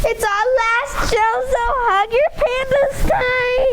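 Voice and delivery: fake-sob through the goodbye; mock crying. Kids saying goodbye on their last show, mock crying.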